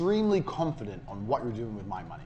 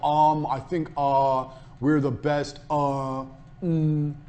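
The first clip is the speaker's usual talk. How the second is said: halting, slow and unsure with fillers; unconvincing hesitant pitch; hesitant umming; much slower than usual. Shown as a demonstration. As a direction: stumble over it like you're not sure. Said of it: "Did I go".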